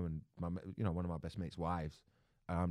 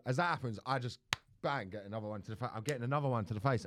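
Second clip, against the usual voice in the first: normal talking voice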